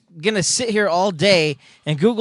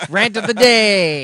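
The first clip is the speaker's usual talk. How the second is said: slow, louder and higher, like a show title card; announcer title voice; 5 semitones higher, 6 dB louder, slower than usual. Acted. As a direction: boom it out as the episode's headline. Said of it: "I'll put a big, uh, title on the top".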